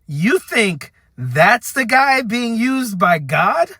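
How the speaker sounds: retold astonished challenge; incredulous. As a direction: stretch it out in sheer disbelief, rising at the end. Acted climax of disbelieving challenge.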